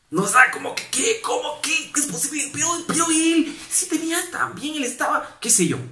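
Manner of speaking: high, incredulous outburst; mock indignant disbelief. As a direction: play the outraged church member. Mimicking an indignant reaction.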